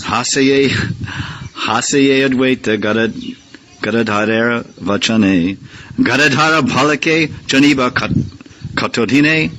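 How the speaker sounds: slow, chanted Bengali verse; verse recitation. Acted. Teacher reciting Bengali verse to the class, slow and chanted. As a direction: intone the scripture line.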